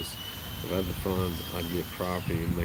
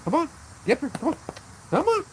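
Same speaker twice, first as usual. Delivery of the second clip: very high, louder squeaky coaxing; high coaxing call; 5 dB louder than usual. Real, not acted. Owner coaxing his dog to jump onto his lap, very high squeaky call. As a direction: coax the dog up like calling a puppy.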